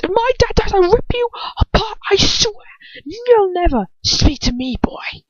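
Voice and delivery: high, tearful, furious; desperate threat. Acted. Ron swearing revenge on Lucius, tearful fury. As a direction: threaten him through tears.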